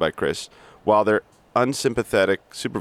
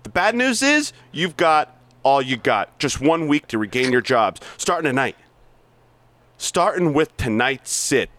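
hard-driving sales boss berating staff; bully the salesmen with contempt; reading Blake's tirade, pushy and mocking; aggressive sales boss; 10 semitones higher, 3 dB louder than usual. Plays Blake monologue.

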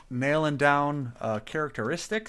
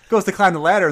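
ordinary talk

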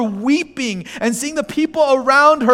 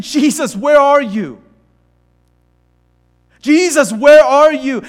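rising into a loud anguished plea; pleading cry; 4 semitones higher, 7 dB louder than usual. Retold or imitated. A preacher voicing a grieving sister's plea, loud and anguished. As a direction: call out for Jesus with growing desperation. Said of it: "you're saying".

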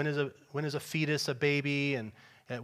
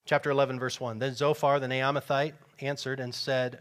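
normal talking voice

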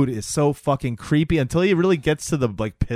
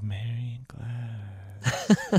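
put-on very slow, softer creepy boy voice; creepy slow drawn-out; 7 dB quieter, much slower than usual. Host imitating the eerie boy saying the name, very slow. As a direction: stretch the name out like a dead-eyed kid. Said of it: "He goes".

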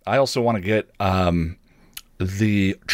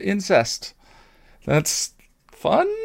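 dry mock enthusiasm, higher pitch; deadpan irony, pitched up; sarcastic; 10 semitones higher, much slower than usual. Real, not acted. Sarcastic joke about writing incest.

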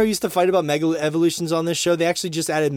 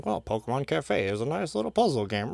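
mocking whiny voice; 5 dB quieter than usual. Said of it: "you guys are gonna yell at me".

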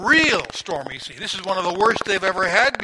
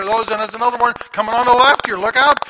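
alarmed warning; 3 semitones higher, 7 dB louder, faster than usual. Retold or imitated. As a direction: hurry the warning out in a panic. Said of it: "James over here".

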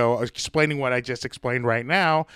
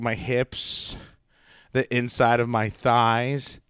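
ordinary talk

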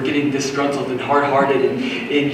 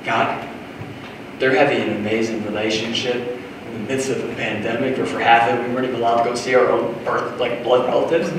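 soft, lower, searching prayer; quiet humble prayer; 4 semitones lower, 6 dB quieter than usual. Retold or imitated. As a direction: ask God gently. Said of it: "stop to ask and go".

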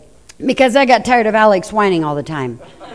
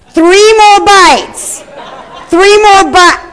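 high, loud, slow "three more bites"; pleading parent; 9 semitones higher, 6 dB louder, much slower than usual. Retold or imitated. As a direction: coax a kid to finish. Parent bargaining with child.

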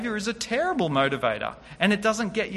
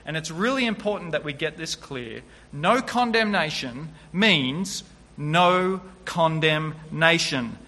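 normal talking voice

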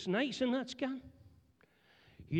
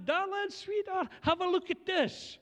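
much higher, sugary darling-sweetheart call; sweet wife voice; 9 semitones higher than usual. Retold or imitated. Wife showing husband the first scan, much higher and sweet. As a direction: coo it like a delighted wife.

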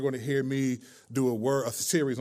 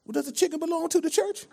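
put-on old church lady voice; 13 semitones higher than usual. Prank caller asking restaurant about church, old lady voice. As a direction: ask sweetly like an elderly churchgoer.

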